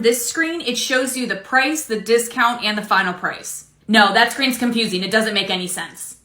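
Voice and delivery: ordinary talk, nothing marked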